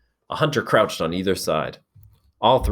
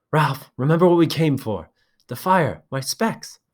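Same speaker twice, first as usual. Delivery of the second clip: anxious urging; 9 semitones higher, 4 dB louder than usual. Acted.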